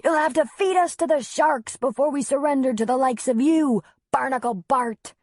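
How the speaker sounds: put-on high, loud, defiant boy soldier; brave young soldier. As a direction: taunt the pirate bravely. Child defies pirate in game.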